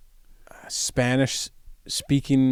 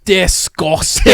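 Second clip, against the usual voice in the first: mock Scottish accent; 6 semitones higher, 7 dB louder, much slower than usual. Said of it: "the Scottish man".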